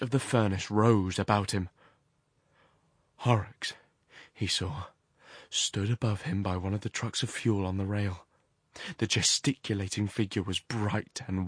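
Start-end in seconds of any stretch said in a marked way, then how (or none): none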